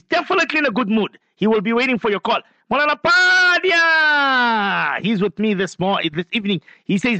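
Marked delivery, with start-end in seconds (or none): 2.7-5.0 drawn-out greeting. Real, not acted. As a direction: stretch the name out, higher and louder. Host warmly hails a friend on air.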